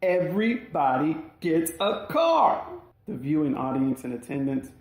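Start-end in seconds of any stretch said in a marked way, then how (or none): 0.1-2.6 emphatic proclamation. Retold or imitated. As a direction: savor each word of the giveaway. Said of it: "accentuating the figurative quotations".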